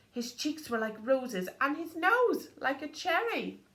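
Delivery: ordinary delivery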